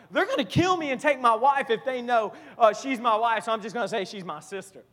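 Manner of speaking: a touch of hurried scheming, acted out; lightly voiced anxious Abram. Abram reasons out his lie about his wife, lightly acted worry.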